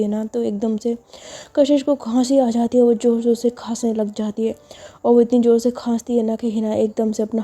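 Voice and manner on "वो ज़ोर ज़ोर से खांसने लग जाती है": ordinary delivery, nothing marked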